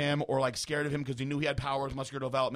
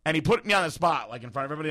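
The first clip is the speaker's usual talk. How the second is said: ordinary delivery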